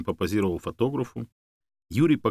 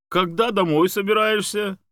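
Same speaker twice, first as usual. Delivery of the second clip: high, loud questioning; calling out; 11 semitones higher, 5 dB louder than usual. A narrator's reading. Academic asking the dog about coming home, calling out. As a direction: raise the voice to reach the listener.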